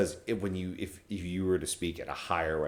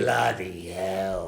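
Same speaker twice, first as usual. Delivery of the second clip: slow, drawn-out British "Bloody hell"; hammed-up British exclamation; mock British accent; 4 dB louder, much slower than usual. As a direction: say it like Ron Weasley, slow. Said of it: "oh, Ron Weasley".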